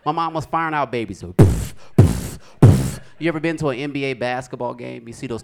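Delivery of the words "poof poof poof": forceful puffed cannon blasts; loud bursts of air imitating a cannon; cannon poof. Imitates babies fired from cannon.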